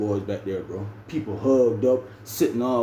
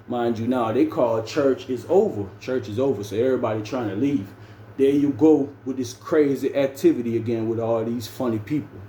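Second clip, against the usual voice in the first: usual speaking voice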